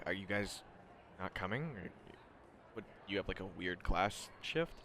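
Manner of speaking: quiet, puzzled student; soft, low, halting question; Shinso. Acted. Student asking friends if they're coming to class, quietly puzzled. Game master voices Shinso.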